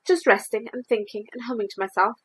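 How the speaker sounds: usual speaking voice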